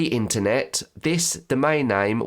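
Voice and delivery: usual speaking voice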